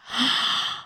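a high unvoiced gasp; shocked gasp. Narrator reacts to the man's rudeness, gasping. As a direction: gasp in disbelief.

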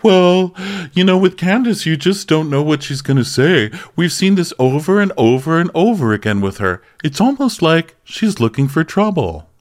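high, put-on voice for a castmate; mocking Robin. Robin's quoted stirring, acted.

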